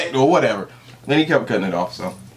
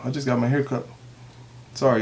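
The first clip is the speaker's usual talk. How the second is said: ordinary delivery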